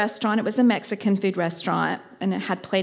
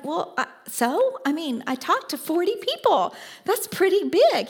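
defensive protest; 8 semitones higher than usual. Retold or imitated. Defending herself to her coach, rising indignant protest. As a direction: protest to her coach that forty people counts.

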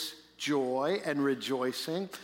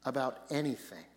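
scripture reading; 5 semitones lower than usual. Acted. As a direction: read the verses with reverence. Reads the scripture passage aloud.

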